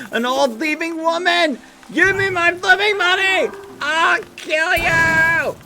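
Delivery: angry shouting. Acted. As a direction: explode at the old thief. Renewed rage at the grandma.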